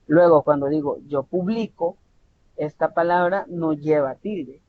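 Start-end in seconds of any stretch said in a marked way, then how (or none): 1.1-2.0 "yo publico" with the force on BLI; stress on middle syllable. Shown as a demonstration. Teacher showing where vocal force falls, middle syllable of "publico" stressed. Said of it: "la mayor fuerza vocal se identifica".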